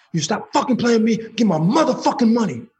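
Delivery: high-pitched retold angry outburst; talking big. Caller's last threat to a voicemail before hanging up, acted big talk. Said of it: "I'm talking big shit".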